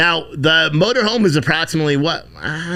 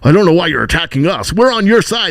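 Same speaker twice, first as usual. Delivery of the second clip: higher, louder whiny protest; mocking the reporters, higher and louder; mocking media voice; 5 semitones higher, 6 dB louder than usual. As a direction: play the indignant reporter. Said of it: "And they're like".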